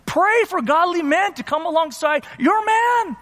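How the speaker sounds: high-pitched, fervent preacher's urging; impassioned exhortation. Real, not acted. Preacher urging the sisters to support their men, impassioned.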